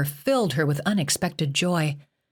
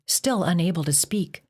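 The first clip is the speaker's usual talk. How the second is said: ordinary delivery, nothing marked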